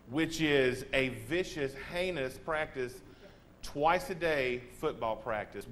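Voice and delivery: usual speaking voice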